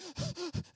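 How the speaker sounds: imitated sobbing intake of air; short hitching gasps; deep gasping breaths. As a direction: gasp for breath between sobs. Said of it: "my wife starts taking deep breaths".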